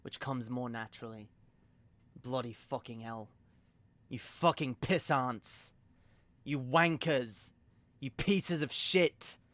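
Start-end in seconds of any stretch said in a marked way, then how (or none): 2.2-9.4 edgy British villain voice, drawn out; slow, contemptuous British insults; Lord Darkay voice. Shown as a demonstration. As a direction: spit insults in a British character voice. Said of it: "the Lord Darkay voice".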